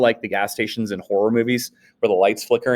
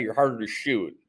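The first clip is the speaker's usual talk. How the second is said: ordinary talk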